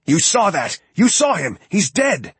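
high-pitched, agitated exclamations; frantic insistence. A narrator's reading. Lloyd turning to Felix after the fall, frantic insistence.

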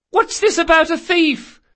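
very high, loud question; granddaughter calling down. Acted. Granddaughter upstairs to grandmother, high called question. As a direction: call down in a high girlish voice.

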